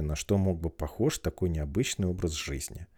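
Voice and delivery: ordinary delivery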